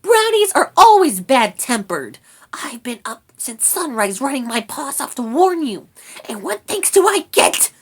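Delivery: high, loud rat tirade; aggrieved little rat; bristling anger. A narrator's reading. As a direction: rant indignantly in a squeaky voice. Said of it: "was bristling with anger".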